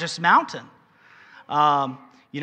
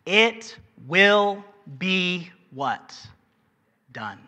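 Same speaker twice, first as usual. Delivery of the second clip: slow it will be, pause, then done; dramatic pause before done; much slower than usual. Shown as a demonstration. Preacher building suspense to the word done. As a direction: hold the listeners, then land done.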